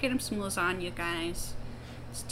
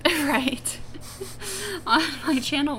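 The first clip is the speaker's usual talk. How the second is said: laughing; 8 semitones higher, 5 dB louder than usual. Real, not acted. Laughing at the Garfield joke.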